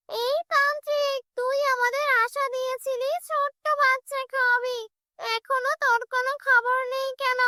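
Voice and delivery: high-pitched demanding witch-spirit; high, imperious ghoul voice; menacing shakchunni. Acted. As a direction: confront the sorcerer as a hungry she-ghost. Shakchunni demands child from tantrik.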